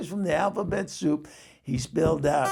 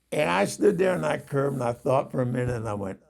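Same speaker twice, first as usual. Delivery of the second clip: normal talking voice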